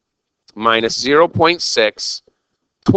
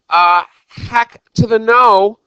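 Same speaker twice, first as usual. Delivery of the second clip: emphatic no; 8 semitones higher, 4 dB louder, slower than usual. Real, not acted. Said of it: "emphatic denial to class".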